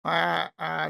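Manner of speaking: ordinary talk, nothing marked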